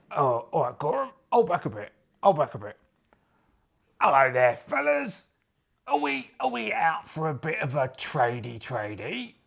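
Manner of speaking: chummy geezer voice, lilting up and down; matey adventurer. Acted. Adventurer hailing a stranger to trade, matey geezer voice. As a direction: play a chatty, slangy adventurer.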